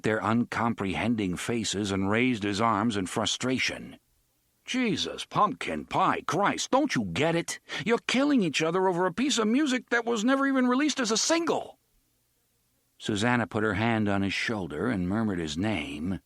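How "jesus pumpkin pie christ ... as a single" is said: rising, fast, exasperated shout; exasperated outburst. Acted. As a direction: blow up in disbelief. Eddie explodes in frustration.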